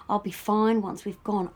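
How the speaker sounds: normal talking voice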